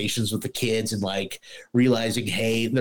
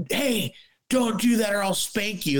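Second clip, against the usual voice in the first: mock wife's idle threat; 10 semitones higher than usual. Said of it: "'Cause you're always too fucking busy".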